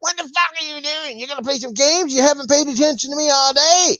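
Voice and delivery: put-on high-pitched nagging girlfriend voice; mock angry girlfriend. Mimicking a jealous girlfriend scolding him for gaming.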